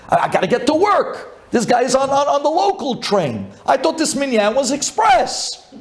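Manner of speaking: high, whiny complaint; mock griping worshipper, pitched high; whiny impatient congregant. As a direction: gripe like a man late for work.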